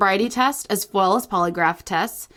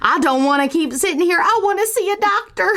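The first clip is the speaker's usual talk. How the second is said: put-on Southern accent; 7 semitones higher than usual. Improvising an alien abduction witness, Southern accent. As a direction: play a rattled Southern witness. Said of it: "If you like our Southern accent".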